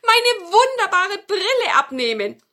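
high, loud witch voice; witch complaining. Acted. Witch tells grandmother about the wizard after her glasses, high urgent plaint. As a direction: plead in a high-pitched witch voice. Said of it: "sagte die Hexe".

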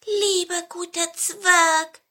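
high, drawn-out coaxing; pleading pine cone. A narrator's reading. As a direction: plead sweetly in a little creature's voice. (hohe, bittende Zapfenstimme)

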